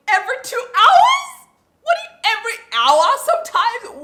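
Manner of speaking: outraged disbelief. Real, not acted. Outraged at feeding every hour.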